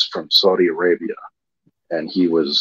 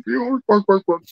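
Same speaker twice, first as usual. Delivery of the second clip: much higher, sing-song Muppet Swedish Chef voice; squeaky, bouncy mock Swedish chef babble; bouncy Muppet chef gibberish, pitched way up; Swedish Chef impression. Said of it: "the chef from the Muppets".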